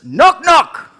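high, loud knock knock; comic demo. Shown as a demonstration. Comedian demoing cricket-paced comedy, loud knock knock. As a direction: announce the joke big. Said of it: "it would sound like this".

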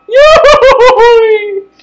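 very high, loud, slow yodeling whoop; cartoon yodel. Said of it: "He kicks the chair from under himself and goes".

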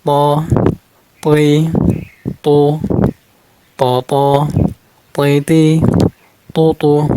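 প, পই, তো thick, tongue root lifted; heavy letter drill. Shown as a demonstration. Teacher drilling learners on a heavy letter, প, পই, তো made thick. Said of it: "গোড়া উপর দিকে তুলে দিতে হবে".

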